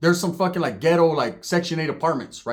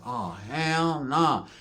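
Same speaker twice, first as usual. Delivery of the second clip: drawn-out, softer inner refusal; slow dismay; 5 dB quieter, much slower than usual. Retold or imitated. Told to sit down by homie, slow dismay. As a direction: stretch each word in dread. Inner reaction to menacing host.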